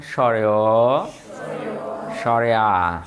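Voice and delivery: স্বরে অ and স্বরে আ, slow and low with long final vowels; drawn-out vowel names. Shown as a demonstration. Teacher reminding the class of Bengali vowels, স্বরে আ drawn out. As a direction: hold the last vowel of স্বরে অ, স্বরে আ. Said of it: "আমরা বলছি না".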